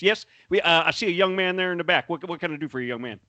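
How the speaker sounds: Southern fan-boat tour guide; swamp tour guide. Acted. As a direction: play a folksy New Orleans swamp guide working the crowd. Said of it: "what you would be like if you were doing a fan boat swamp tour".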